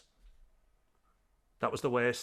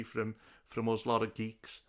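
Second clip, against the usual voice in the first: ordinary talk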